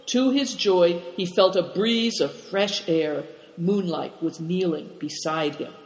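usual speaking voice

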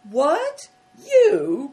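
high, loud astonished outburst; incredulous cry. A narrator's reading. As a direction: cry out in disbelief, each word jumping up. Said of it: "cried the soldiers".

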